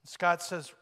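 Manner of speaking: ordinary delivery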